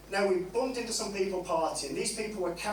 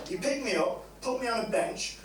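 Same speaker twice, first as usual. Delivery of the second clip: normal talking voice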